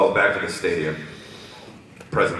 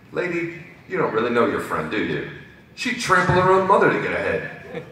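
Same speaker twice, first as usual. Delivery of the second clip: high-voiced Brooklyn know-it-all; lightly put-on Brooklyn talk; Brooklyn accent; 7 semitones higher, 5 dB louder than usual. Reserve warning Rarity about her friend, Brooklyn accent. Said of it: "talk like they're from Brooklyn".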